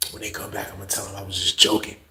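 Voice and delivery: low, quiet scheming voice; hushed. Retold or imitated. Cellmate quietly telling narrator his plan, hushed. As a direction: keep it down so the guards won't hear.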